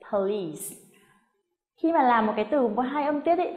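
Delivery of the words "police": "police" as puh-LEESE, ending in s; two clear syllables. Shown as a demonstration. Teacher modelling the word for learners, two-syllable "police" with final s. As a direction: stress the second syllable of "police", long ee. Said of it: "mình sẽ nhấn trọng âm".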